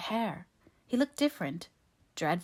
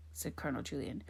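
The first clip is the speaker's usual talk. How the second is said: ordinary delivery, nothing marked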